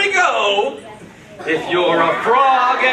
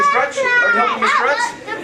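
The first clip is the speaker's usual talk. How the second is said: high playful voice; 12 semitones higher than usual. Real, not acted. High playful question to kids.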